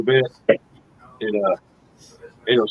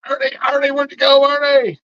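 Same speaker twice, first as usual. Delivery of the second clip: calling out. Real, not acted. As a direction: call after him across the room. Calling after departed viewer.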